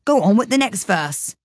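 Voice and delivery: hasty push to move on. Acted. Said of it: "said the Gryphon hastily".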